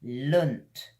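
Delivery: Lunt with tongue to the roof of the mouth; tongue curled. Shown as a demonstration. Teacher showing learners the final syllable. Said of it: "Need to get your tongue curling".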